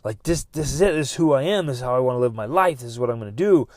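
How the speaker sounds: loud burst on "Yeah", then firm conviction; fired-up affirmation. Acted. Reading one's own journal each morning, fired up. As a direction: pump yourself up. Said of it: "and I'm like".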